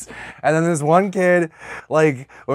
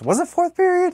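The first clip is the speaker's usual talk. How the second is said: high squeaky question. Real, not acted. Questioning a detail.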